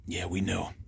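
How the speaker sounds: very soft, low subdued reply; imitating held-back tears. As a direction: imitate someone quietly holding it in. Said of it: "these people were trying not to cry".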